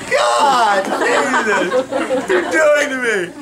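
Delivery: high, loud mock scream, laughing through it; burned client hollers again in a half-laughing mock wail; retold scream. Client screams at the stylist after a second burn, high and loud. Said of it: "She slept out another scream".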